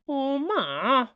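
high, whining sons; whiny protest. Acted. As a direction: whine it like scolded boys. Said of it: "protested both werewolves".

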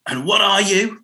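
cheery DJ. Retold or imitated. Mock DJ cueing the caller for the jingle. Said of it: "you'd time it to go".

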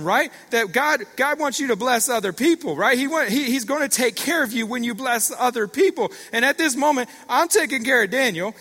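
high, loud, excited preaching; preaching at full force. Real, not acted. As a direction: build to a shouted, amazed peak.

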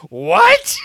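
high, loud incredulous what; shocked what. Retold or imitated. Host reacting to a friend's wild story, shocked. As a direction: blurt it out in disbelief. Hearing the torn bicep story.

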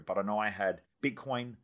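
usual speaking voice

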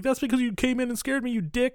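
high, snippy retort; mock annoyed girl. Karen scolding Mike after a scare, mock snippy. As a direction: snap back in a put-on girl voice. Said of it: "she's like".

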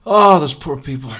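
mock loud lament. Pitying the soldiers for viewers, loud mock lament. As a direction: wail it like mock mourning.

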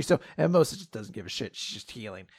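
normal talking voice

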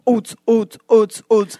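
steady rhythmic thumps; imitated techno bass line. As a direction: pulse it evenly like a beat. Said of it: "you have this one line".

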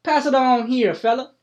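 high, loud country voice; an old farmer happy to get his hat back; hillbilly farmer, delighted. Acted. GM voices Cletus Ray.

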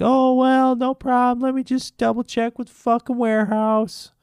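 high-pitched mock customer-service voice; mock store clerk. Store rep stalling an annoyed customer, mocking high voice. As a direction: imitate a useless clerk way up high.

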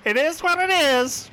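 high, loud squawking parrot. Acted. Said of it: "His parrot squawks out".

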